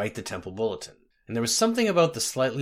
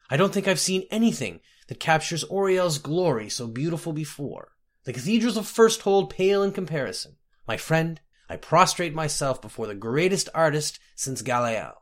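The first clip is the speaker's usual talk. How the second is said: higher-pitched, effusive archpriest; gushing archpriest; 4 semitones higher than usual. Acted. Archpriest writing to praise the scribe's bulletin, gushing. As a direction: pour on the praise as a moved archpriest.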